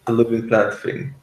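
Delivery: ordinary talk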